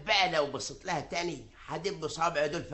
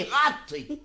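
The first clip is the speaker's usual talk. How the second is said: laughing; 4 dB louder, much slower than usual. Acted. Laughing after covering her.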